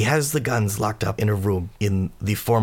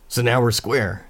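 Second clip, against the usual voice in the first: ordinary talk, nothing marked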